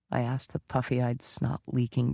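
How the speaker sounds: ordinary delivery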